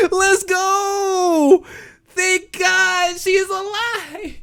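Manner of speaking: very high, ecstatic shrieks; real overjoyed screaming. Real, not acted. Reader learning Ashley is alive, real overjoyed screaming. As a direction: scream with joy and relief. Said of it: "I was so happy".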